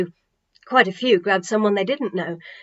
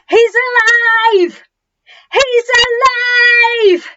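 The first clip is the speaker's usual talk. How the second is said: high, loud, slow jubilant cry; joyful shout; 13 semitones higher, 8 dB louder, much slower than usual. A narrator's reading. Crowd shouting he's alive at the top of their lungs. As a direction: yell it to the whole street. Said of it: "They were all shouting at the top of their lungs".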